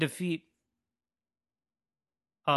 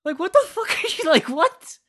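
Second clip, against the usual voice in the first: much higher, louder disbelief; can't believe what you're hearing; shrieking what-the-hell outrage; incredulous outburst; 12 semitones higher, 4 dB louder than usual. Real, not acted. Disbelieving rant at Pennywise.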